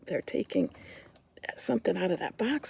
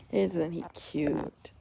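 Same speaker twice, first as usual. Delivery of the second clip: usual speaking voice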